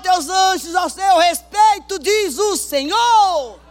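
loud, slow, drawn-out scripture proclamation; fiery pulpit declamation; preaching at full force. Acted. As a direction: thunder the verse out over the music. Preaching scripture over music.

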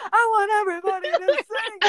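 high, loud yell to the crowd; retold scream. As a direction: belt it out like you're rallying a stage crowd. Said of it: "and I screamed".

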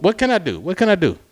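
high-pitched, snappy retold comeback; irritated retort. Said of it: "I'm getting irritated".

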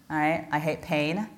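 ordinary delivery

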